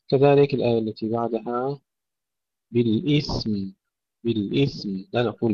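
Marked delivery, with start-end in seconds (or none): 2.7-5.0 بالإثم with th straight into audible m; still tha, clear mim. Shown as a demonstration. Teacher modelling for students, clear ending in بالإثم. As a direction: hold ث then hum م in بالإثم. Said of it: "سكون الثاء ونظهر الميم".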